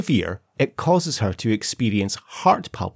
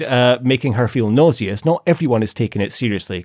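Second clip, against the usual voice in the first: usual speaking voice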